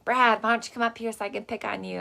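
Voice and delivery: put-on high, calling Michigan-accent grandma. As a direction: call for Brad in a Michigan accent. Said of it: "in her Michigan accent".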